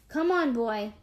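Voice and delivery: shout. A narrator's reading. Girl urges Spot off the roof, mild shout. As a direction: coax the dog with a restrained shout. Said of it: "I shout".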